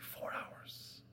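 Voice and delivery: whispered disbelief, barely voiced; stunned whisper. Classmates hear the pastor reads four hours, stunned whisper. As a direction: gasp it out under your breath in shock.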